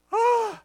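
high, loud breathy cry; acted loud gasp. Man as the bear finally leaves, acted gasp. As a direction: let out a sudden high exhale.

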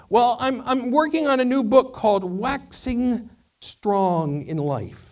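high, loud, slow philosopher candle; second candle. Acted. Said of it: "it says".

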